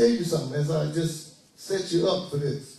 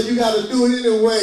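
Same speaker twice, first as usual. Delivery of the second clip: "You gotta do it anyway" belted much louder, stress on "do"; louder call; 3 semitones higher, 8 dB louder than usual. Shown as a demonstration. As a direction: push the congregation to shout it back. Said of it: "y'all don't like talk back".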